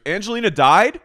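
high, loud shocked question; mock shock. Host imitating the caller shocked that Angelina died. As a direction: blurt it in disbelief. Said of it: "he goes".

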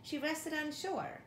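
ordinary delivery, nothing marked

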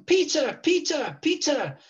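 put-on calling out high and excited in a Scottish accent; excited Scottish shout, pitched high; retold shout. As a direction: hail a friend across the room, Scottish accent.

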